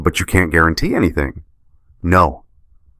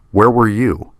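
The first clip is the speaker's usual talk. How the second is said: pressing demand; 5 semitones higher than usual. Acted. Judge presses absent father.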